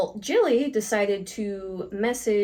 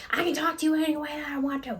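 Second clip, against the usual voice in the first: quick, high, mocking snap of the angry coworker; defiant coworker retort; 6 semitones higher, much faster than usual. Coworker defying her boss, mocked in a quick high snap. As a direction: throw it back at her fast and defiant. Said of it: "She goes".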